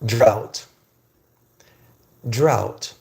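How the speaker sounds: "Drought" said firmly as an isolated word; pronunciation demo. Shown as a demonstration. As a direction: model "Drought" with a silent G, pausing between repeats. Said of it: "This is pronounced".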